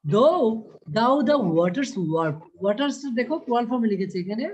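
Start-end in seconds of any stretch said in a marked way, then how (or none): 0.0-2.4 slow, louder recitation; poem recital. Shown as a demonstration. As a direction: declaim the verse slowly. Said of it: "এরপরে কি বলছে দেখো তো".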